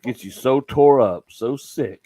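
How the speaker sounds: ordinary delivery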